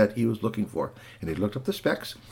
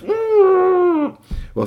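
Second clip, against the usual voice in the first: perturbed; 7 dB louder than usual. A narrator's reading. Mom reacts to ketchup.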